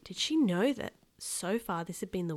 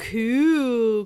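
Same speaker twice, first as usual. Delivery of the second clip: put-on loud, drawn-out kid's squeal; excited squeal; 4 semitones higher, 12 dB louder than usual. A narrator's reading. Kenny learns his brother is a ninja, excited squeal. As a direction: squeal it with delight. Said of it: "Kenny squealed".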